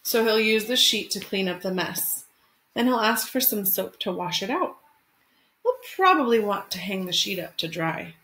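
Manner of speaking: gentle picture-book read-aloud; expressive storytime narration; reading to children. Acted. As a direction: read it like storytime for your class. Teacher reads picture book aloud.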